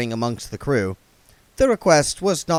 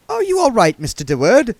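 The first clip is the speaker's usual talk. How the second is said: high, fussy genteel voice; prissy old vagrant, fussing; duck man voice; 8 dB louder than usual. A narrator's reading.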